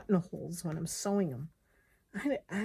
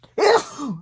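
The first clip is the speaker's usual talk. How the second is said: sneezing; 9 semitones higher, 13 dB louder than usual. Real, not acted. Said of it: "I think I'm gonna sneeze".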